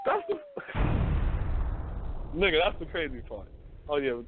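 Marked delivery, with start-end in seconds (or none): 0.4-2.3 breathless high-pitched wheeze of a laugh; high wheezing laugh. Real, not acted. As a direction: crack up silently, up high. Cracks up at his own point.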